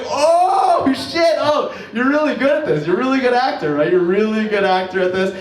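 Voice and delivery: mock excitement. Excited reaction to her acting.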